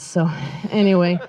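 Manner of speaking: normal talking voice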